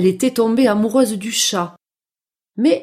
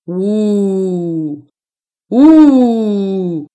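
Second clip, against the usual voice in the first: long imitated wolf howl, swelling louder; 3 semitones lower, 8 dB louder than usual. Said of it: "quel beau cri". (hurlement de loup imité)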